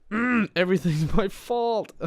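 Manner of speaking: high, slow whiny mock wail of self-blame; hammed-up woe-is-me, drawn out high; mock self-pity. To himself about the group, a high whiny mock lament.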